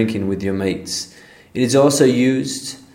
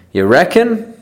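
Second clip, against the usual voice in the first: high, rising surprised "You reckon?"; doubtful you reckon; 7 semitones higher than usual. Acted. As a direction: question it, raised and doubting. Acted doubtful reply.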